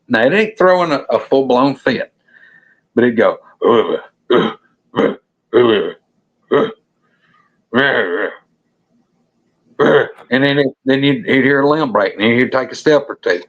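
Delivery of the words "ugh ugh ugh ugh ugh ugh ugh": imitated creature grunting. Hunter imitating the growling thing in the bushes, grunting. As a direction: grunt in short bursts like the beast. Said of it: "It's grunting".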